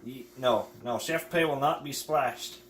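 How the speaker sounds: deliberately bad Irish accent; worst Irish accent. Partner cooking chips for the video, in a bad Irish accent. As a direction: ham up a bad Irish brogue. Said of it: "with the worst Irish accent you've ever heard".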